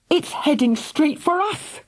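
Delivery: breathless, high gasp. A narrator's reading. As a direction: gasp it out in alarm. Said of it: "gasped Philly".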